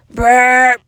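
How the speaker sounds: loud pretend burp. A narrator's reading. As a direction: blurt a big voiced burp.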